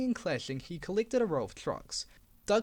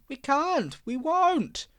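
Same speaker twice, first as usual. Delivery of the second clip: much higher, louder shrieking refusal; screaming refusal; 8 semitones higher, 6 dB louder, much slower than usual. Acted. Jerked trucks refusing Diesel, shrieking.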